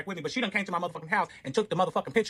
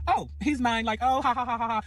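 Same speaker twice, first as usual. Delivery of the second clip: high, smug teasing taunt; mock taunting; 6 semitones higher than usual. Narrator taunting the ex on TikTok, smug mock taunt. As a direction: gloat at her online. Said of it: "I'm taunting this bitch".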